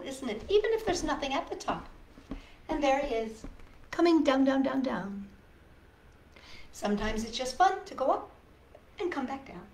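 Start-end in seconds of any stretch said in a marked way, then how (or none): none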